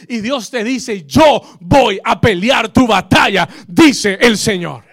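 loud preacher's proclamation, peaking in a shout; fiery preaching. Acted. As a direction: preach it with force, swelling at the end. Preaching at full force.

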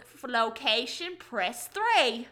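Dax Shepard. Retold or imitated. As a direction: announce the option in a hammed-up impression. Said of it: "Dax Shepard does this one impression".

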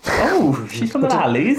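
light Welsh lilt; Welsh accent. Acted. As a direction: say it like someone from the Valleys. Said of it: "I said it in a Welsh accent".

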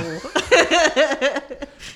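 a loud burst of real laughter. Real, not acted. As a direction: laugh out hard at your own story. Laughs at own story.

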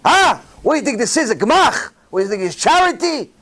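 high-pitched, indignant mock yell; retold yelling. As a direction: imitate an exasperated teller yelling at a customer. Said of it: "he started yelling at the woman".

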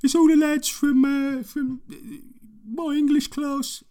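put-on high, loud teen boy voice; squeaky kid voice, way up high; fourteen-year-old boy voice. To the customers, mimicking the teen club boy. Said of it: "He was like".

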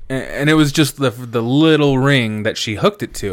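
normal talking voice

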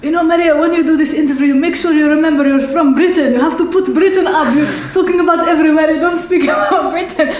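fast, high, loud dad impression through laughter; laughing dad impression, fast and high; impersonating dad. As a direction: rattle off dad's lecture, laughing. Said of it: "so he was like".

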